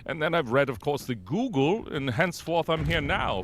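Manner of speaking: smug, arrogant British pontificator; Nigel Knife. Acted. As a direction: lecture with pompous British self-satisfaction, pitch often lifted. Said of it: "the most smug and just arrogant".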